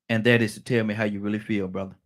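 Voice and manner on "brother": ordinary delivery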